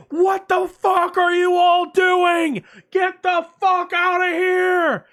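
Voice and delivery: high, slow, furious shout; screaming fire marshal. Retold or imitated. Fire marshal with no bullhorn screaming at everyone to leave. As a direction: bellow it at the whole hall. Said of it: "He just starts screaming".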